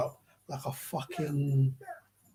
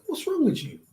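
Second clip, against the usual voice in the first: mocking, much higher pitch; dismissive doctor; 11 semitones higher, 5 dB louder than usual. Doctor scoffing at his patient's outburst, mocking high voice. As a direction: sneer at the patient's reaction. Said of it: "he looked at him like".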